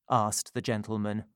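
ordinary delivery

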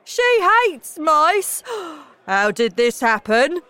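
loud, high, horrified father with a gasp; crying out. A narrator's reading. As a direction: exclaim in dismay, gasp, then demand an explanation. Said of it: "cried Mister Jenkins".

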